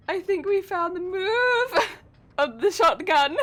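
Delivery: laughing through the words. Real, not acted. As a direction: giggle while saying it. Laughs mid-sentence at teammate.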